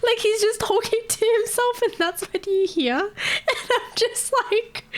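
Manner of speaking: words squeezed out high through giggles; laughing. Real, not acted. Streamer retells the story while still giggling.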